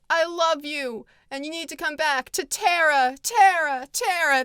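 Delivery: mock pleading, hammering Terra; mock Scarlett pleading. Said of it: "she's like".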